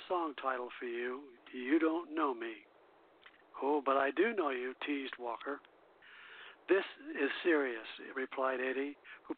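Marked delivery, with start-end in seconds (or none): none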